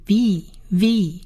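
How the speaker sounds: "V" drawn out slowly with long ee; long ee vowel. Shown as a demonstration. Teacher blending consonant and vowel, slow long "V". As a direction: stretch the vowel in each "V". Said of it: "是长义的音".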